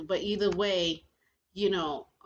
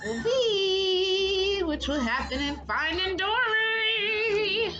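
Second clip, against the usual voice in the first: teasing; 12 semitones higher, 4 dB louder, slower than usual. Pretend. Teasing the sequel.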